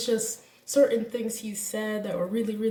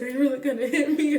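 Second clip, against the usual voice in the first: laughing mock quote; 4 semitones higher, 4 dB louder, faster than usual. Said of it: "And he was like".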